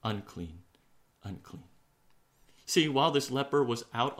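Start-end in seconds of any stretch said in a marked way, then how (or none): 0.0-1.7 low, hushed self-pronouncement; subdued murmur to oneself; calling to himself. Acted. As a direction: say it inwardly, softer and fading away. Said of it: "calling out to himself".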